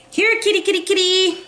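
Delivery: high-pitched pretend kitty call; calling a cat. Reader playfully calling a cat over, high-pitched.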